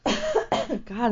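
coughing and choking; strained after a cough. Real, not acted. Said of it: "I'm choking today".

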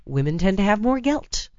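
ordinary talk, nothing marked

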